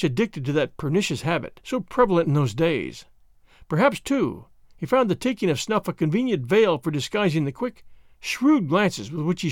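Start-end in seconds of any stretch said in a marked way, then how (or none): none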